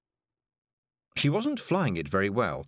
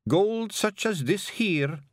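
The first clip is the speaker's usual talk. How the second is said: high, slow creature voice; strange creature; 11 semitones higher, much slower than usual. A narrator's reading. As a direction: bargain slowly in a high, odd voice. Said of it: "it added".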